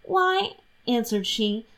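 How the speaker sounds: lightly voiced guileless young wife; Catherine's innocent voice. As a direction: rattle off the excuses innocently. Catherine answers.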